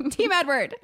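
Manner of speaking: mock fan cheer for "Team Edward". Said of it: "And I said".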